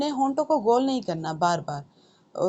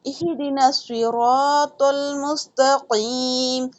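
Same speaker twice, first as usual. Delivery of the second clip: slow chanted नअबुदु व इय्याका नस्तईन; Quran recitation; 4 semitones higher, much slower than usual. Shown as a demonstration. Teacher reciting for students, slow and melodic. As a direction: recite the verse slowly and melodically.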